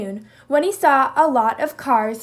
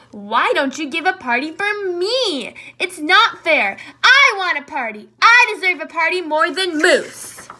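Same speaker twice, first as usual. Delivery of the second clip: put-on higher, louder childish insistence; whiny demand; 5 semitones higher, 6 dB louder than usual. Jackal demanding a party from Zebra, whiny and loud. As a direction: demand it like a spoiled kid.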